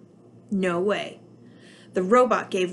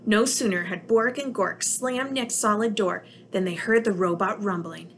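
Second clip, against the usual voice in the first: ordinary delivery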